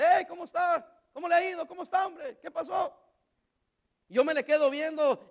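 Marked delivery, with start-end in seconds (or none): 0.0-3.0 put-on higher, breezy hello from an old friend; a friendly pastor checking in, pitched up; cheery pastor greeting. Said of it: "se acerca el pastor Hurtado y me dice". (saludo alegre y agudo del pastor al encontrarlo)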